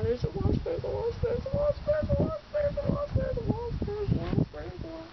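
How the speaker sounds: high, rising panic, then fading; panicked. Real, not acted. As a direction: repeat it in growing alarm. Wasp appears, panics.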